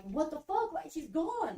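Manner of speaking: high-pitched, exasperated protest; retold angry outburst. Grieving daughter arguing with Jenna, angry. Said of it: "like, angry".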